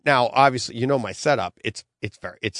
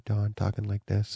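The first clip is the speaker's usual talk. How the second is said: much softer, lower voice; lower audio level; 4 semitones lower, 9 dB quieter than usual. Shown as a demonstration. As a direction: drop down as if badly under-recorded. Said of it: "you'd have different audio levels".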